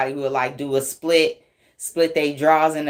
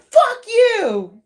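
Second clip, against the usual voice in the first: very high, loud put-on shriek; mock mad scream; 6 dB louder than usual. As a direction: act out someone furious, screeching. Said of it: "This person is so mad".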